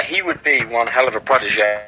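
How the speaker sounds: usual speaking voice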